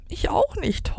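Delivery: very high mock wail; storybook little howl. A narrator's reading. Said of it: "heulte Flapp".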